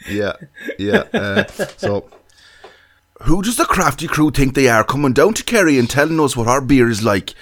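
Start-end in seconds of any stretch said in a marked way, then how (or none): 3.2-7.3 put-on loud, indignant Kerry accent. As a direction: play an offended Kerry local in a Kerry accent. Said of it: "do his best Kerry accent".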